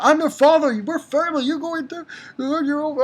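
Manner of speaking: high, stammering mock-father voice; hammed-up pleading father. Father pleading with his son Loxus, hammed-up high stammer. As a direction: plead in a silly high voice, stumbling over words.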